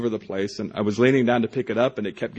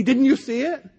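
incredulous friends; 3 dB louder than usual. Retold or imitated. Friends amazed at him.

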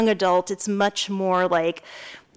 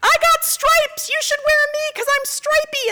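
high cartoon voice bragging; mother voicing a bragging dress to her daughter; boastful dress voice. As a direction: brag like a toy dress.

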